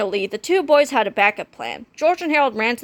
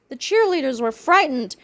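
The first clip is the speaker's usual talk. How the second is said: usual speaking voice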